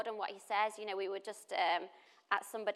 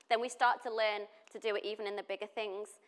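ordinary talk, nothing marked

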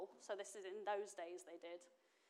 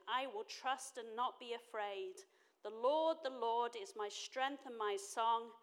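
scripture reading; 5 dB louder than usual. Acted. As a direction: proclaim the psalm to the congregation. Reads Isaiah scripture aloud.

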